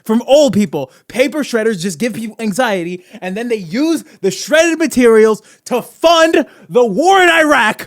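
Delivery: hammed-up, emphatic conspiracy ranter; conspiracy theorist rant. Conspiracy host exposing paper shredders to listeners, hammed-up outrage. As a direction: rant with mock outrage, punching key words.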